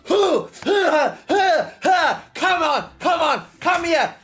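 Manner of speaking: high, loud, fierce mock battle taunts; hammed-up aggressive raider; hyped cutthroat raider. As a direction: shout it like a bloodthirsty raider charging. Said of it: "I'm just exaggerating it".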